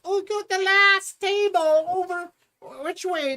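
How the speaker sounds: very high, loud, dismissive mimicry; mocking vague directions. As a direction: ham up a careless wave-off. Imitating director's vague pointing.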